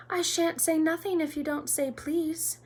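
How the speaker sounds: high, lilting poltergeist tease; annoying sing-song. A narrator's reading. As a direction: chant it to needle him. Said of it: "said Peeves in his annoying sing-song voice".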